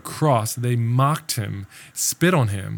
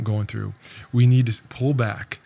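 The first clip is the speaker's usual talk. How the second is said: ordinary talk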